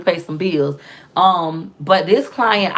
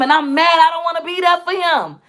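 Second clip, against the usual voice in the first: voicing anger; 8 semitones higher than usual. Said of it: "he want me to be there".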